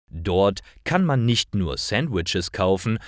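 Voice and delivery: normal talking voice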